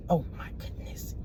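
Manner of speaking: breathless hushed whisper; stunned whisper to herself; whispered aside. Real, not acted. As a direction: breathe it out in disbelief, barely voiced. Reacting to turning a blind eye.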